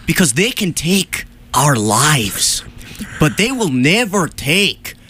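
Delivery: a hint of a rousing Braveheart speech in a Scottish accent; slow mock-heroic Scottish rally. Host mock-rallies for the radio show in a Scottish accent. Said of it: "It's what I'm going for".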